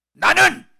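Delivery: high, loud self-assertion; defiant shout. Acted. Proud man refusing salvation through Jesus, defiant shout. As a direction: burst out with it at full volume.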